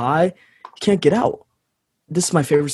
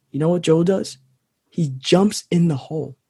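ordinary talk

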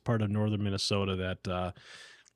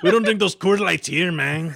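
louder, higher mock Mexican accent; 5 semitones higher, 4 dB louder than usual. Mimicking the friend's botched line in a mock Mexican accent. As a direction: parody an Iron Range accent slipping into Mexican. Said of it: "that almost turned Mexican".

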